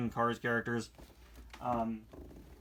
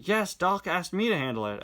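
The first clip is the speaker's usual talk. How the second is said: ordinary talk, nothing marked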